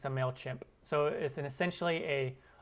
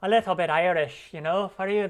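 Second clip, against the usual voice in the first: higher, louder Irish lilt; playful Irish accent; 4 semitones higher, 8 dB louder than usual. Shown as a demonstration. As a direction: slip into an exaggerated Irish brogue. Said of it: "start to sound Irish a little bit".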